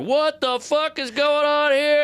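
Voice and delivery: high, drawn-out outraged yell; mock flipping out. Angry car owner to the street, mock flipping out. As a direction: imitate an irate guy losing it. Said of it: "flipping out".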